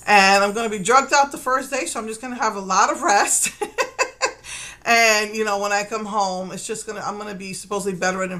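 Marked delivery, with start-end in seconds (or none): 3.5-4.8 high breathy giggle; laugh. Real, not acted. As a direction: giggle at your own joke. Laughs at joke about resting.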